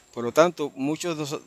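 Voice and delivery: ordinary talk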